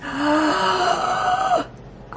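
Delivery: piercing excited gasp; shrieking gasp. Real, not acted. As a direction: gasp in shock at the color. Gasps at swatch.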